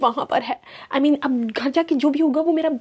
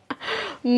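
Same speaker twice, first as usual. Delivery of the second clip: short laugh; 8 semitones higher, 5 dB quieter than usual. Real, not acted. Laughs at her own story.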